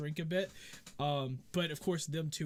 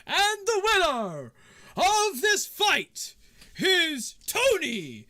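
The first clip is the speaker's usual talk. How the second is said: booming, drawn-out, high-pitched announcer shout; fight announcer; 13 dB louder, much slower than usual. Acted. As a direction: announce the winner to a roaring arena, stretching every name. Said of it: "you hear the announcer goes".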